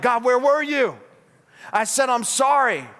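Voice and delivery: acted-out indignant whine at God; mock complaint. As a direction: plead your case to God, put out. Acts out someone complaining to God.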